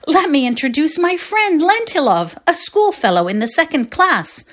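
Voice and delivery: high, eager introduction; eager boy. Acted. Volodya introducing his school friend, eager. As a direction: proudly present your friend. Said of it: "cried Volodya".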